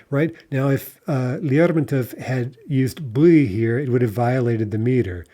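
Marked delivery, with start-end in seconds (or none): none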